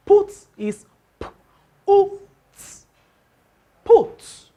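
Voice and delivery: "put" broken into p, u, t, all high and loud; spelling out sounds. Shown as a demonstration. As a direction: sound out each letter of "put", then say it whole. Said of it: "the words like".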